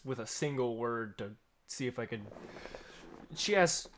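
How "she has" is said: put-on a first stab at an Australian accent; trying out her Australian accent, unsure; attempt at Australian. GM testing the barista's Australian accent, tentative. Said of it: "she has, like, an Australian accent".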